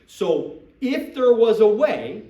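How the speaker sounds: IF punched loud and drawn out; slow, careful conditional with IF hit hard; stress on IF. Shown as a demonstration. Recruiter hedging a promise to a prospect, stressing IF. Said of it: "you see how I'm saying it".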